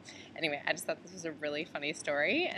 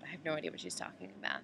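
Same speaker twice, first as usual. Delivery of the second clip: usual speaking voice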